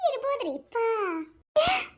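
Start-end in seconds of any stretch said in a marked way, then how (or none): 1.6-1.8 mock posh. Mock posh English reply.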